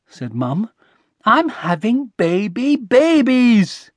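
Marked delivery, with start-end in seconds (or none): 1.3-3.9 high, slow, spelling out the news; gleeful announcement. A narrator's reading. Mum correcting Connie with the baby news, gleeful. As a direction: savour each word, rising in excitement.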